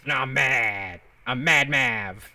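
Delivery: slow put-on grumpy voice; mock mad. Streamer naming himself Mad Mav, mock anger. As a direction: play at being furious, tongue in cheek. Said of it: "Look at me in my mad face".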